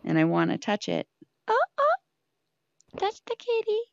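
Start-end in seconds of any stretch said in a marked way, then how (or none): 1.5-3.8 squeaky coo to the cat. Real, not acted. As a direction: coo at the kitty. Baby talk to the kitty.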